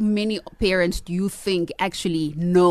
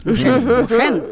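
Chinese accent; 5 semitones higher, 4 dB louder than usual. Shown as a demonstration. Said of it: "You should have said it in a Chinese accent".

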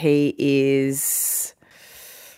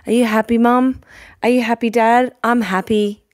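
put-on sweet little boy; 6 semitones higher than usual. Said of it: "he's like".

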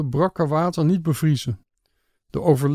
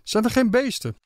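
higher-pitched, worried questions; anxious calling out; 7 semitones higher than usual. A narrator's reading. Guests calling out questions to their guide, raised and anxious. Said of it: "Mijn gasten begonnen door elkaar te roepen".

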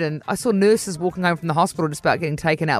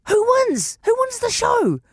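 high, insistent grandma voice; imitating nana. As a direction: press for the answer like a nosy nana. Nana demands the show's winner.

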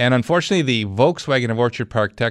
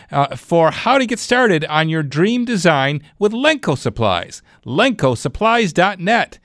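upbeat radio ad read, pitched up; hard sell ad; 4 semitones higher than usual. Acted. Live read of a sponsor ad.

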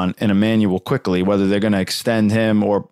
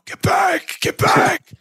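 high shouting coach impression; Tibbs yelling; much slower than usual. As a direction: bark it like an angry coach on the sideline. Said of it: "better Tibbs yelling and screaming at everybody".

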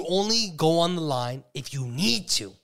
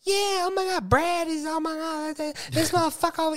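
exasperated mock rant; 12 semitones higher than usual. Mocking someone in line.

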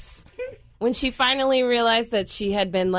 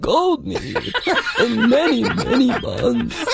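Other Mother; 3 semitones higher, 3 dB louder than usual. Retold or imitated. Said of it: "In that, in that voice".

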